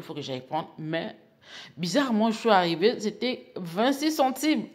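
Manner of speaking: usual speaking voice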